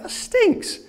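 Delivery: high, hammed-up disgust; mock disgust. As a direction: recoil from the smell. Discovers it was sick.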